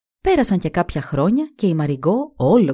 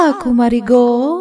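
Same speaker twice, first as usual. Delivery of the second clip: higher, louder call ending in a rise; third goddess; 5 semitones higher, 7 dB louder than usual. Acted. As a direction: call her name like a spirit. Third goddess speaks in her ear.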